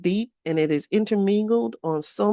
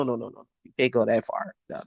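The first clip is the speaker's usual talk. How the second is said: usual speaking voice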